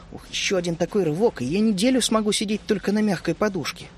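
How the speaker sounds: plain reading voice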